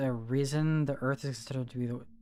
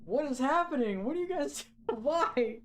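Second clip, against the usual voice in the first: high, slower, disbelieving squeals; rising panic at what chat is posting; horrified squeak; 11 semitones higher than usual. Real, not acted. Horrified by chat's bug messages.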